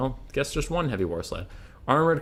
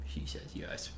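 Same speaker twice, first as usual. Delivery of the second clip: whispering; 17 dB quieter than usual. Real, not acted. Answer for the dog.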